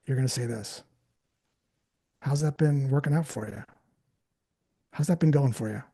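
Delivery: low, relaxed, unhurried questioning; very calm voice. Shown as a demonstration. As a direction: lean back and ask it calmly. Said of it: "in a very calm voice".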